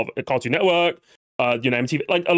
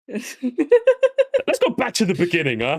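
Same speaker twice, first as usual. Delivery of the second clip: slow, high, teasing video-essay voice; video essay host; 7 semitones higher, much slower than usual. Pretend. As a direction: draw it out like a smug essayist. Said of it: "a leftist video essay".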